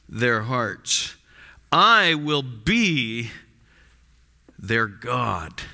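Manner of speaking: slow, weighty, stressing put, write and be; slow emphatic reading. Shown as a demonstration. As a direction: read each promise slowly and heavily. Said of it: "let me read it with some emphasis".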